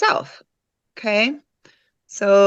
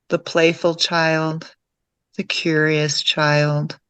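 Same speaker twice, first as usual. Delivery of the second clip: calm meditation guide, lower than chatting, with long pauses; guided meditation; 4 semitones lower than usual. Real, not acted. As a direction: guide them gently inward, leaving long silences between phrases.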